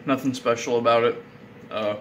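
chewing while talking; talking around food; mouth full. Real, not acted. Seen 0: Closed, working mouth between words; candy bar and piece held elsewhere.

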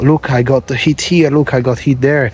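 normal talking voice

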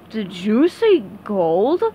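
slow, greedy crab voice with a sly smile; sly Mister Krabs. Acted. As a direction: savor the word gold. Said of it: "with a sly smile".

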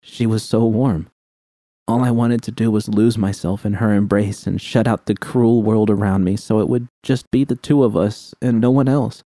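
plain reading voice

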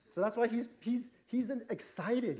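high, slow, bursting with eager joy; excited. Acted. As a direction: gush like a toddler who sees his food coming. Father acting out son's excitement.

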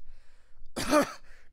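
harsh smoky coughing; coughing fit. A narrator's reading. Courier choking on thick smoke, coughing hard. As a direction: hack out the smoke. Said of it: "started coughing their lungs out".

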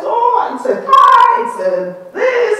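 high, slow, drawn-out mock wail; mock complaining. Churchgoers voicing their problems, mock wailing. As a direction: imitate people loudly listing their troubles. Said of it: "Everyone is saying their problems".

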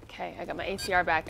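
ordinary delivery

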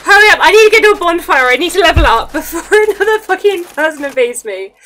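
fast loud urgent rush; hurried and anxious. Real, not acted. Rushing to a bonfire.

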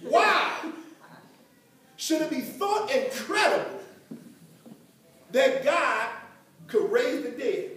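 punched opening word, then long pauses between phrases; forceful, deliberate Bible quote; emphatic scripture reading. Acted. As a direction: proclaim the verse piece by piece. Repeats the verse emphatically.

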